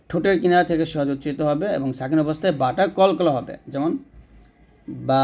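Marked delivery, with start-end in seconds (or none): 5.1-5.2 বা said thin from the lips; light letter demo. Shown as a demonstration. Teacher modelling a thin বা. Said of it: "পাতলা করে".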